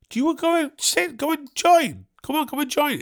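high, fast eager invite; high imitating voice. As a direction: mimic them chirping an invite. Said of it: "they asked me".

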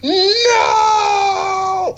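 long, high, loud wail of no; hammed-up anguished scream, stretched right out; melodramatic scream. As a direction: imitate an over-the-top grieving cry. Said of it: "goes up to him and screams".

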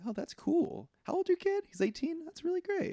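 high, soft imitated approval; easy approval. Neighbor hears about her kid, easy approval. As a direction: act out being keen in a small high voice.

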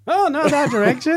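very high startled cry; panicked pilot, wrong way; alarmed. Acted.

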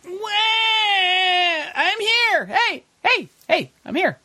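whiny brat wailing, very high; Marco Rubio. Acted. Host mocking what he hears when the candidate speaks, whiny. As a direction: squeal it like a teenage brat demanding attention. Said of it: "He sounds like a seventeen-year-old whiny brat".

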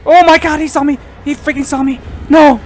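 high panicked screaming. Real, not acted. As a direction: shriek in real panic. Enemy spots player.

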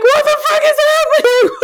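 high, startled what the fuck; shocked, laughing and crying at once; stunned outburst. Retold or imitated. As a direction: blurt it out in teary disbelief. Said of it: "I'm like".

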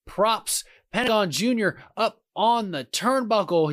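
ordinary delivery

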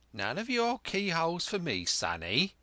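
high-pitched warning, dropping on the last word; sly pirate warning off an eavesdropper. Acted.

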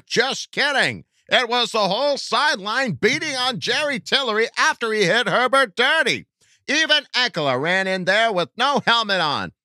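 very high-pitched character voice; squeaky-high put-on voice for a fan; excitable fan character. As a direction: read it out as a falsetto superfan.

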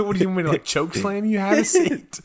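loud, high mock scolding through amusement; retold exasperated outburst. Counselor fed up with defiant boy, loud mock exasperation. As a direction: build to a high, loud order.

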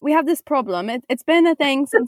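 put-on British accent. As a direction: explain it as a pretend Brit. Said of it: "we, we turn into Brits".

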